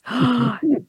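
drawn-in breath of pretend shock; hammed-up startled gasp; mock gasp. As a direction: gasp theatrically.

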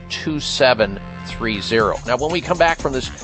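ordinary talk

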